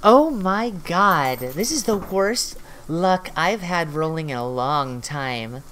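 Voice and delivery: ordinary talk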